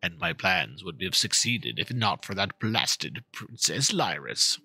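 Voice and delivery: low, gloating villain impression; mock the dragon's boastful grumble; lightly voiced storybook villain. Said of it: "if he were the one telling the tale, something like".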